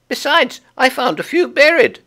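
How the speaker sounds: very high, bright little chipmunk. Acted. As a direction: squeak it out like a tiny chipmunk. Chipmunk character voice.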